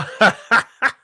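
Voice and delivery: high laugh that breaks out; real laugh. Real, not acted. Laughs after the hype call.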